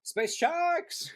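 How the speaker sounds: very high playful squeal; high-pitched mock cheer; excited squeal. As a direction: squeal SPACE SHARKS like an overexcited fan. Mock excited echo of space sharks.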